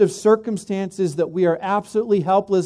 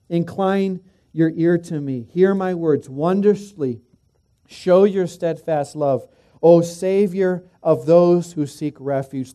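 fervent reading; 5 dB louder, slower than usual. Shown as a demonstration. Preacher rereads the verse, building.

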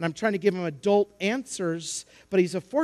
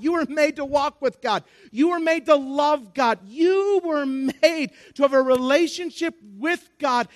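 raised, higher-pitched sermon delivery; impassioned preaching; 7 semitones higher, 4 dB louder than usual. Acted. Preacher to congregation on being made for God, impassioned. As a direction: drive each phrase home from the pulpit.

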